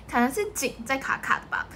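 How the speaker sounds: ordinary talk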